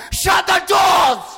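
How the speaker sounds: drawn-out, high, very loud scream; slow full-force shriek of command. A narrator's reading. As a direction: scream the order, stretching the last word. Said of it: "she screamed".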